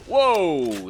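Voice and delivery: loud, drawn-out whoa; startled whoa. Real, not acted. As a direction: blurt it out high and loud. Startled by enemy during game.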